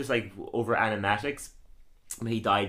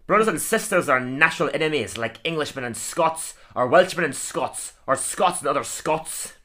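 higher, louder Scottish grump; Groundskeeper Willie; 5 semitones higher, 4 dB louder than usual. Acted. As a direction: grumble it like a cranky Scottish caretaker. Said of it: "Today I'm gonna do Groundskeeper Willie".

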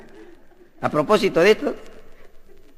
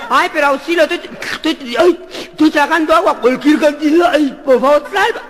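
drowning plea; 8 semitones higher, 5 dB louder than usual. Acted. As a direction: wail for help theatrically. Drowning man begging. (grito afeminado y agudo de auxilio)

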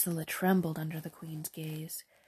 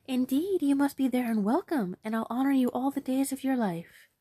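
high, louder, joyful promise; pitched-up delighted young girl; overjoyed girl; 6 semitones higher, 5 dB louder than usual. Acted. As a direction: gush out her thanks. Ursula overjoyed accepts.

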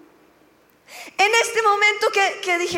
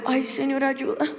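pleading murmur; 5 semitones lower, 6 dB quieter than usual. Retold or imitated. Desperate prayer under her breath.

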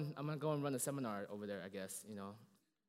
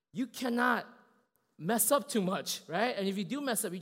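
louder, higher, slowed on key lines; forceful preaching; 7 semitones higher, 9 dB louder than usual. Acted. Preacher warning the congregation's leaders, forceful. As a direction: drive the warning home to leaders.

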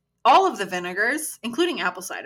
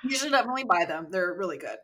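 usual speaking voice

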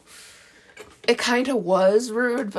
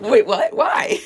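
wait, what flung high, why shrieked way higher and louder; mock surprised squeak; 11 semitones higher, 7 dB louder, slower than usual. Podcaster retelling her reaction to a friend, in sudden high disbelief. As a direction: play up the baffled shock. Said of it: "I was like".

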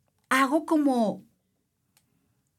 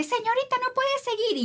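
much higher, squeaky officious voice; mock official; 13 semitones higher than usual. Official trying to stop her from continuing the course, squeaky mock voice. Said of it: "así de que".

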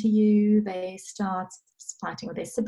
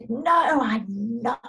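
high, loud, stretched-out "no", easing off; mock little-kid refusal; imitated defiant child; 4 semitones higher, 3 dB louder, much slower than usual. Mother acting out her daughter refusing the sink, defiant.

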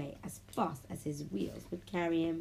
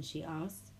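plain reading voice

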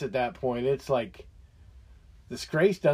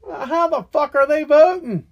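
high, loud outraged yell; angry shout; 9 semitones higher, 6 dB louder than usual. Real, not acted. Ranter yelling at viewers about immigrants voting, furious shout. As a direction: blast the question in disbelief.

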